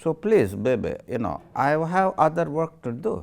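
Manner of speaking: mock Russian accent with laughter breaking through. [name] as a Russian messenger urges Anastasia home, put-on Russian accent laughing. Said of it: "Very similar".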